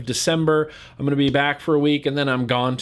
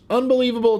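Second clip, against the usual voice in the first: exasperated; 8 semitones higher, faster than usual. Real, not acted. Outrage repeated louder.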